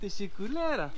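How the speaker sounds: a tourist trying out Turkish; Turkish thank-you attempt. Thanking the fruit seller in mock Turkish accent. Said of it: "Il est comment, mon accent?".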